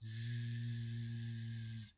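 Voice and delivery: a sleepy drawn-out snore; snoring. A narrator's reading. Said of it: "Amy snored".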